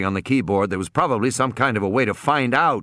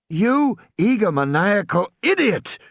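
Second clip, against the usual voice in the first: fury; 9 semitones higher than usual. Acted. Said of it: "Malcolm said in a fury".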